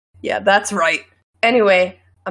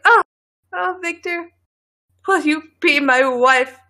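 higher, hammed-up romantic plea; mock swooning proposal; 6 semitones higher than usual. Acts out dramatic marriage proposal.

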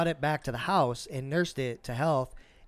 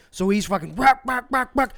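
higher, louder bark imitation; mock barking; 8 semitones higher, 7 dB louder than usual. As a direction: bark it out like the pig.

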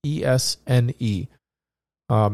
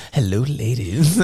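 mock seductive; slower than usual. Host plays up seductive voice.

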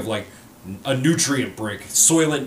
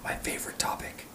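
whispering; 14 dB quieter than usual. Real, not acted. Aside while host introduces topic.

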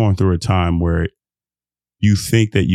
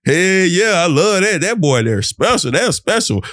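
future listener's praise; 8 semitones higher than usual. Retold or imitated. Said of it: "somebody listening to this".

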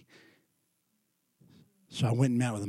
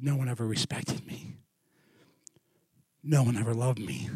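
hushed, understated imitation of a man in tears; subdued, soft retold confession; retold weeping; 7 dB quieter than usual. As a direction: quote a broken man softly. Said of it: "began to weep and began to cry".

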